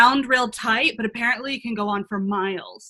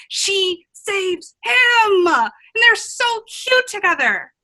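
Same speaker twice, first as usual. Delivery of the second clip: high, drawn-out squeal of delight; excited gushing; 6 semitones higher, 3 dB louder, slower than usual. Acted. Traveler tells friends of princess saving prince, excited gushing. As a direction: gush over an adorable couple. Gushes about a romantic tale.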